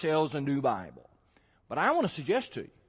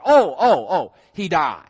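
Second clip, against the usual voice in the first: loud oh, oh, of a penny dropping; sudden realization; 2 semitones lower, 8 dB louder than usual. Pretend. Preacher plays a Bible reader realizing the verse means death, loud surprised realization. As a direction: act the reader suddenly getting it.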